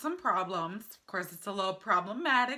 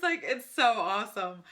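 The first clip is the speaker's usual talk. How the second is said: normal talking voice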